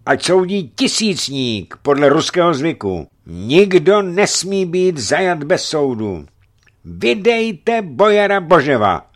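high, loud demands; angry shouting. A narrator's reading. Angry townsman demands a trial for the boyar, shouting. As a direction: hurl the demand at the clergy.

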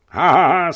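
loud, high belly laugh; roaring laughter. A narrator's reading. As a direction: laugh with your whole chest. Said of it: "smál se na celé kolo". (hurónský smích)

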